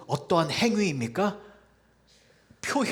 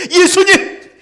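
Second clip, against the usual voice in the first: crying out; 14 semitones higher, 12 dB louder, slower than usual. Acted. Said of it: "입은 살아있기에".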